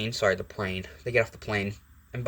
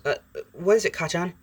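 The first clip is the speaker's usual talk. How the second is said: higher, stammered startled waking; just woken up; 6 semitones higher than usual. Acted. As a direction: stumble awake, confused question. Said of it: "wakes up and goes".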